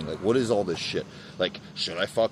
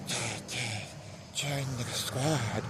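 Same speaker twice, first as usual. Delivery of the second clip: soft, drawn-out mock sales pitch; mock recruiter; 2 semitones higher, 10 dB quieter, much slower than usual. Imagining going around recruiting, mock recruiter. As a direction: imitate a cult recruiter, hushed and slow.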